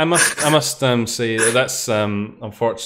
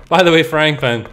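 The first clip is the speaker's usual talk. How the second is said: higher, louder mock announcement; insistent game reminder; 5 semitones higher, 6 dB louder than usual. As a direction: pipe up brightly with the reminder. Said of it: "it's like".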